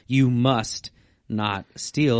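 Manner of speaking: "must" slow and heavy; each word dragged out, "must" leaned on hard; stress on must. Shown as a demonstration. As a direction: read it like a congregation repeating after you. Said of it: "repeat that word with me that's underlined".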